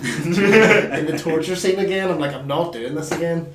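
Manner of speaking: laughing, slow and loud. Real, not acted. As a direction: laugh through the story. Laughing retelling frustration.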